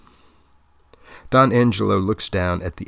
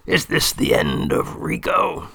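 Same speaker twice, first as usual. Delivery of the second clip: put-on dying old man; 8 semitones higher than usual. A narrator's reading. Dying old man's line.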